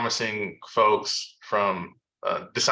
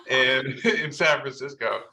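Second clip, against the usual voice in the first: laughing; 4 semitones higher than usual. Real, not acted. Fond memory of meeting.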